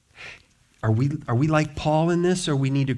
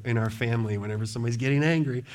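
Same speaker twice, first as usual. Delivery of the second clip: ordinary talk, nothing marked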